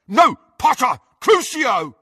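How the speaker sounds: high, loud cry; shouted curse. A narrator's reading. Crabbe curses Harry as he lunges, shouting. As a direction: shout to stop him, then curse.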